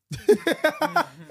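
high, loud laugh that breaks out; burst of laughter. Real, not acted. Mother laughing hard while telling the story of her fallen toddler.

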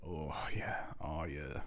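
low, soft mock-pleasure murmur; ASMR moaning. The homie overheard on microphone, ASMR-like. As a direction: imitate a blissed-out buddy, low and hushed. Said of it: "it's just A S M R of the homie".

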